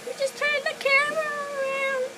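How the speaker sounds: squeaky high cartoon voice; silly high voice. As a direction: say it in a goofy squeaky voice. Repeats line in silly voice.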